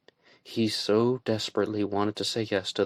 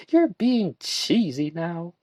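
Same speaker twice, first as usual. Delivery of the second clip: teasing; 9 semitones higher, slower than usual. A narrator's reading. Joanna teasing Barry.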